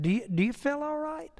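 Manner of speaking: quietly voiced teasing concern; Andy teasing Barney with a soft worried question; mock concern. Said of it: "He just said it like that".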